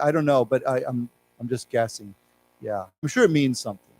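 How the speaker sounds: usual speaking voice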